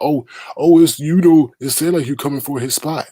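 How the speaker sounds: high mock-gossipy voice; a mocking imitation of a messy friend; mocking Bree. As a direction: mimic her stirring the pot. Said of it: "she was talking about".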